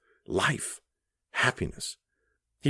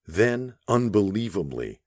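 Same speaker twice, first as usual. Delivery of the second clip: ordinary delivery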